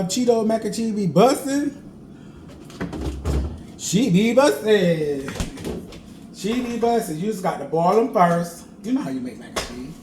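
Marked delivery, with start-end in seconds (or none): 0.0-7.2 excited boast. Real, not acted. Cook bragging to viewers about his mac and cheese, excited boast. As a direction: hype up the dish like it's the best.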